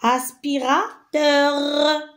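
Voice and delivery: aspirateur drawn out, final teur set apart; slow aspira... teur for aspirateur; syllable by syllable. Shown as a demonstration. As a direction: sound out aspirateur slowly to its last R. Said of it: "et la quatrième case".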